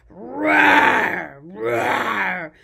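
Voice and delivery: loud playful roar; dinosaur roar. Pretend. Teacher roars like a baby dinosaur, loud. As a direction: roar big for the kids.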